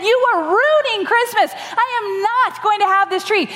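high, indignant scolding; mock angry rant. Mom furious at dad over the scraggly tree, high indignant scolding.

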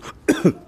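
last coughs clearing the throat; coughing it out; choking on a drink. Real, not acted. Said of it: "me atraganté con el café".

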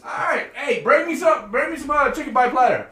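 shouted request. Real, not acted. Asking friends leaving for Marty J's to bring food, shouting. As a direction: yell the order so they hear it far off.

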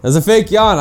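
loud accusation. Real, not acted. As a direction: call out the fake yawn. Accusing friend of fake yawn.

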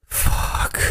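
breathy whispered curse; a tired unvoiced groan. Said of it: "your body's just every morning like".

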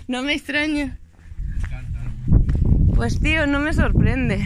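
speaking Spanish with a mock Spanish accent; put-on Spanish accent. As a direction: tease in a half-hammed Spanish accent. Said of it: "quand je prends mon accent espagnol".

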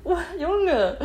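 laughing exclamation. Real, not acted. Laughing exasperation at own mistake.

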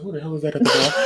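high, fast startled question with a laugh; startled yelp. Retold or imitated. Hearing a knock mid-stream, startled and laughing. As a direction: play it alarmed by the knock, laughing. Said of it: "I was like".

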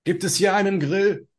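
a high, loud call. Acted. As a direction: call out the question, voice pitched high. Calling out to the house. (hoch und laut rufen)

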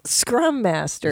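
slow savoring read; slow, savoring drawl of the title; drawn out. Real, not acted. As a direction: stretch it out. Savoring odd job title.

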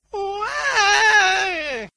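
very high, loud "wah" cry; piercing little baby cry, held long; tiny newborn wail. A narrator's reading. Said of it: "cried in a diminutive voice".

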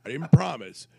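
retold protest. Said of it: "He's like".

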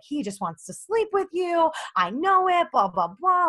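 high-pitched imitation of jealous ranting; mock flipping out. Girlfriend imitating jealous boyfriend on phone, mock flipping out. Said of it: "he just flips out on me".

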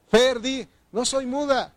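high, loud prompt; rousing call. Real, not acted. Preacher calling on one member to declare it, loud and high. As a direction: call it out for them to echo.

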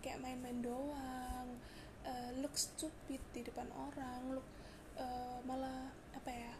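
normal talking voice

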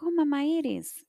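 put-on high, happy little-girl greeting; delighted child. Granddaughter joyfully greeting her grandmother's spirit. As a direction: sing out her name like a glad kid.

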